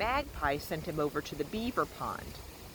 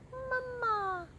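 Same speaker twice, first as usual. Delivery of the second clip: very high tiny peep; peeping gosling. A narrator's reading. Gosling peeping at the robot, squeaky. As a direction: peep it like a baby chick. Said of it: "peeped the gosling".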